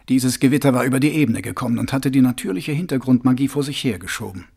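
ordinary delivery, nothing marked

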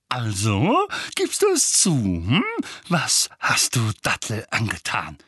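pressing interrogation, pitch leaping up on the questions; bohrendes Verhör. A narrator's reading. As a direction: press for a confession. Onkel verhört Harry zornig. (drohend fragend, mit hochschnellender Stimme)

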